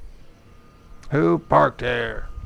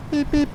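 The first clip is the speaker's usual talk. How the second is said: imitated horn beep; 13 semitones higher than usual. Mocking backseat driver with horn.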